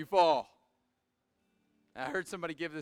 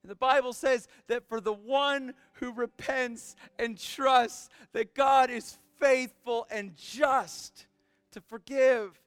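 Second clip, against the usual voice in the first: loud, high-pitched impassioned preacher; full-force preaching; 9 semitones higher, 9 dB louder than usual. Real, not acted. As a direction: drive each phrase home at a near shout.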